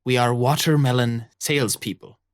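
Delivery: a lightly voiced gruff pirate mother; pirate captain voice. Pirate mother warning her crew to keep the cover, in a lightly gruff voice. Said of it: "tells them with rum-heavy breath".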